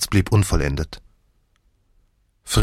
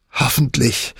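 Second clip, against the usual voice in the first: higher, hateful snarl of a dying man; spiteful, higher-pitched sneer at his wife; 7 semitones higher than usual. A narrator's reading. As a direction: spit the words out with venom. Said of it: "fauchte er gehässig".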